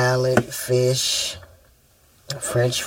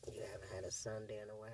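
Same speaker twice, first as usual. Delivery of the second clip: whisper; 4 semitones lower, 28 dB quieter, much faster than usual. Real, not acted. Mom whispering back to child.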